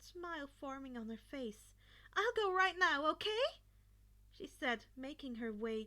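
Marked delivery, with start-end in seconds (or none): 2.1-3.6 higher and louder, breezy mother leaving; cheerful mother. A narrator's reading. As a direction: toss it back on the way out. Mother leaving, calling back.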